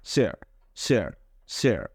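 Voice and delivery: স্যার starting with a clear স, no r at the end. Shown as a demonstration. Teacher drilling the word for students, clearly. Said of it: "আসলে চেয়ার না".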